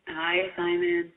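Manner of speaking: usual speaking voice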